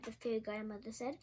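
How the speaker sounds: plain reading voice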